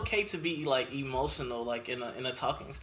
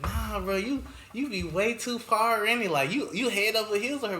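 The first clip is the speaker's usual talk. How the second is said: sister voice; 6 semitones higher, 4 dB louder than usual. Retold or imitated. Sister quoted in story.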